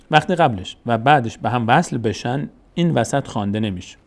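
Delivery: ordinary delivery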